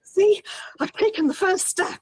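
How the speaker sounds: high, loud, breathless panic; panicked gasping. Retold or imitated. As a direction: gasp it out in a panic. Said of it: "while gasping kind of in panic and in anxiety".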